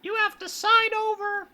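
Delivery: very high squeaky cartoon mouse; chirpy theme-park mascot; Mickey Mouse voice. Mouse explaining.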